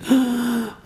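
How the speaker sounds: sharp, high gasp of mock amazement; amazed gasp. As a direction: gasp like an awestruck child. Retelling a child's amazed reaction.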